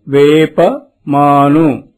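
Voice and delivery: low, careful వేప మాను; teacher modelling వేప మాను; model reading. Shown as a demonstration. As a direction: say it clearly for the class to copy. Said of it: "అనండి".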